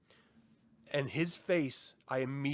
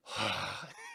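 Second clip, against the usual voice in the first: long breathy exhale of resignation; imitated weary sigh; heavy sigh; 6 semitones lower, 5 dB quieter than usual. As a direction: let all the air out in one sigh. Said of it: "he does this sigh".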